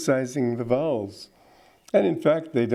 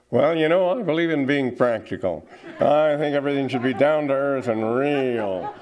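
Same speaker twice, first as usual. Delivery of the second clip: put-on egotistic American accent. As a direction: parody an opinionated, down-to-earth American. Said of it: "There's another aspect to it".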